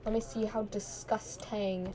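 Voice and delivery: usual speaking voice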